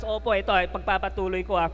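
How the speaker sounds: ordinary talk, nothing marked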